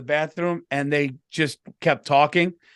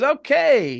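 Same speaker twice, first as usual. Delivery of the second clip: playful; 7 semitones higher, 3 dB louder than usual. Retold or imitated. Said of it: "he was, like, being, like, he was in a good mood".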